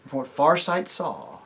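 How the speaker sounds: ordinary delivery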